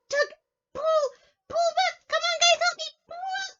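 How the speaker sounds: high loud straining tug calls; straining robin. Acted. Robin puppet tugging the worm, calling for help, high and strained. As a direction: heave and strain like a robin pulling.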